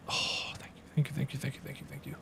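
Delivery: soft unvoiced whisper of gratitude; relieved player whispering thanks for the mount. Real, not acted. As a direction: whisper thanks under your breath.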